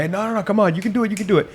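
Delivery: high-pitched pushy coach encouragement; rally a struggling trainee like an old football coach. Retold or imitated. Wrestling coach urging a nervous trainee on, pitched high and pushy. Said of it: "I still kick into, like, the old football mentality".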